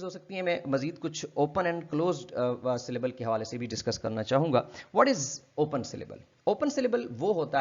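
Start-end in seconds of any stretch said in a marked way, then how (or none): none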